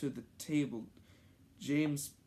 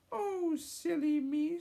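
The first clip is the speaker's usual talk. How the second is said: put-on kid voice; 4 dB louder than usual. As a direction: speak as a small boy. High character voice.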